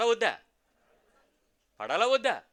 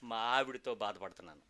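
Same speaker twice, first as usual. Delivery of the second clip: complaining church member; 6 semitones lower, 8 dB quieter than usual. Retold or imitated. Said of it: "ఒక ఆయన అడుగుతాడు".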